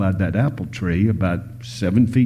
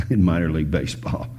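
ordinary talk, nothing marked

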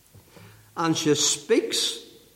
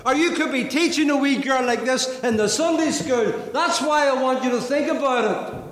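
high-pitched, insistent pulpit appeal; full-force preaching; 8 semitones higher than usual. Real, not acted. Preacher urging those who raise and teach children, full-force preaching. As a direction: press the charge on them in rising, repeated refrains.

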